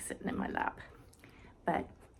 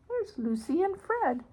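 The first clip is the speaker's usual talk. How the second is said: ordinary delivery, nothing marked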